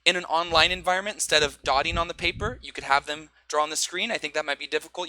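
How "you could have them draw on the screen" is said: usual speaking voice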